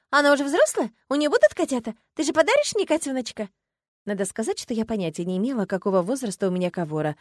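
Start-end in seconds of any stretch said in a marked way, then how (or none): 0.1-3.5 high, eager string of questions; excited chatter. A narrator's reading. Agata begging for a future kitten, excited chatter. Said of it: "и затараторила".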